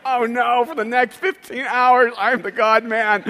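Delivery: loud, high, drawn-out comic lament; hammed-up slow despair; mock dismay. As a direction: groan it slowly in exaggerated dread. Dismay as whole cabin listens.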